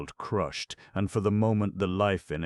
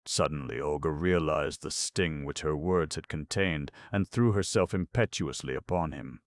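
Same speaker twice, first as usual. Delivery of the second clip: ordinary delivery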